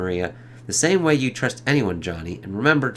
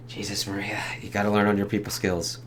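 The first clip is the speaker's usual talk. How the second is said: soft, breathless whisper of disbelief; whispered aside; 4 semitones lower, 7 dB quieter than usual. Real, not acted. Viewer reacting to Maria's bluntness, whispered aside. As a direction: whisper it like you can't believe she said that.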